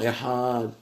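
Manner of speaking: "ehad" said softly with the A stretched; drawn-out syllable. Retold or imitated. Teacher imitating those who take time, "ehad" lengthened softly. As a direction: linger on the vowel of "ehad": ehaaad. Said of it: "prendre du temps".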